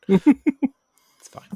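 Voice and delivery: high squeaky laugh-through. Real, not acted. Laughing off own mistake.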